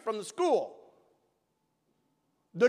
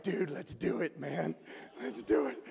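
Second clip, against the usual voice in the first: sneaky agreement; 10 semitones lower, 11 dB quieter than usual. Retold or imitated. Agreeing to prank in class.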